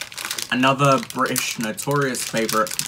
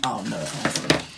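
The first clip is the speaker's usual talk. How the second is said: normal talking voice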